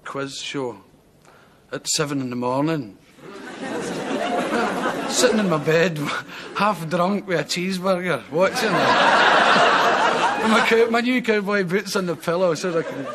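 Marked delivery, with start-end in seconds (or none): 3.2-5.0 breathy giggle. Real, not acted. Laughing at the memory of TV. 9.2-10.4 loud burst of laughter. Real, not acted. Laughing at himself in bed.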